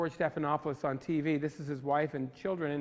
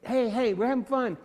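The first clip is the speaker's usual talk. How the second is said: higher, louder, bright cheerleading; cheery pep; 6 semitones higher, 5 dB louder than usual. Retold or imitated. Photographer keeping the posing group upbeat, higher and louder. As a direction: pump the group up, playfully. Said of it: "you're really just trying to".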